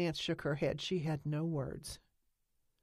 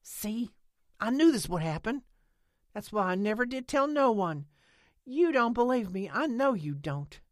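louder, higher, hurt and accusing; angry accusation; 7 semitones higher, 7 dB louder than usual. A narrator's reading. Lena rounds on Nance for not believing, angry. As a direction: hit her with it, angry. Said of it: "She was angry now".

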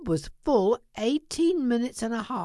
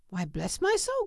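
high-pitched astonished exclamation; surprised cry; 10 semitones higher than usual. A narrator's reading. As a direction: exclaim it high, without shouting. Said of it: "cried Fred".

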